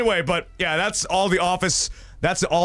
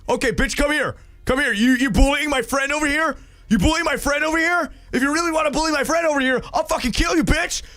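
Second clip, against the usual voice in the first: high, hammed-up tough-guy threat; 10 semitones higher than usual. To the bully picking on a friend, high mock-tough threat.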